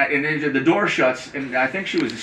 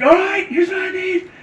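high squeaky voice. Retold or imitated. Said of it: "I'm like".

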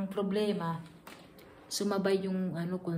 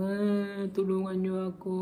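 crying plea; 4 dB louder than usual. Retold or imitated. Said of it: "iyak na naman ako".

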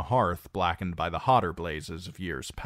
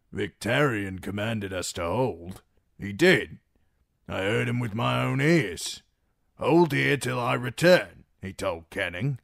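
louder, earnest rough voice; uneasy guard; 4 semitones higher, 4 dB louder than usual. Acted. The door guard defending Victarion's orders, louder and earnest. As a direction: insist on orders.

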